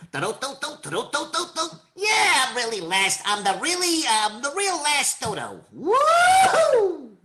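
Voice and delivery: very high, zany cartoon bird; dodo voice. Shown as a demonstration. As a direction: squeal it like a goofy dodo. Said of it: "the dodo".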